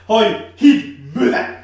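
loud, high barked order; shouted movie quote. Retold or imitated. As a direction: imitate a parent yelling mockingly at their kid. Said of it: "the parents making the fun".

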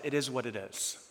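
soft, low-key acceptance; quiet resigned quote. Retold or imitated. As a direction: let it go quietly, a shrug in the voice. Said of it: "I just said to myself".

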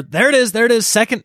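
finally spotting the sign, light and lifted; there it is, relieved and happy; relieved excitement. Real, not acted.

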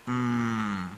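soft sustained hum; voiced "mm". Shown as a demonstration. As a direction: hum a long, even "mmm". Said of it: "que es de la M".

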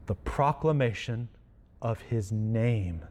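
ordinary talk, nothing marked

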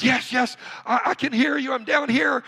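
high, loud, eager reply; acted frantic shout back; urgent calling up. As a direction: call out to a rescuer far above. Fallen man calls up to voice.

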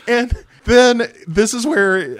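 high, loud, slow build-up; eager high lead-in; excited setup. Real, not acted. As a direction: stretch it out up high.

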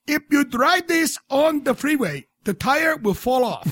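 high, put-on voice for a mechanic; hammed-up high-voiced mechanic; mechanic character voice. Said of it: "my mechanic, he's like".

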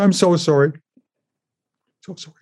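tender, pained consolation; heartfelt sympathy. Retold or imitated. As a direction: retell it with sorrow, trailing almost to nothing. Comforting the abused boy, retold.

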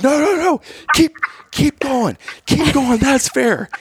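high, urgent yelling to keep running; frantic urging. Retold or imitated. Yelling at his teammates to keep running, high and urgent. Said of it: "I'm like".

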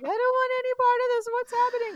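very high, loud, rushed frightened voice; mock panic. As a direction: imitate a terrified girl squealing her protest. Retold panicked quote of sobbing Esme.

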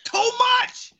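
very high, loud squawk; outraged exclamation. Real, not acted. Host reacts to the cost of co-host's chair, shrieked outrage. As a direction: shout it in comic outrage.

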